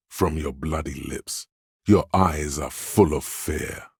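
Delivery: usual speaking voice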